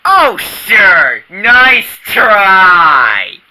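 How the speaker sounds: loud scornful retort; sarcastic shout. Acted. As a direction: yell it back with scorn. Angry mocking retort.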